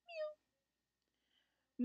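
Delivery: tiny, very high squeaky mew; kitten mew. A narrator's reading. Kitten greets Richard from its cage, tiny high mew. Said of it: "opened his little pink mouth and said".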